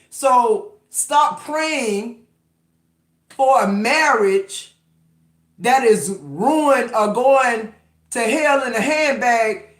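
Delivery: drawn-out, deliberate, every word weighed; slow it right down and drive it home; slow emphatic warning. Real, not acted. Urging listeners to stop praying.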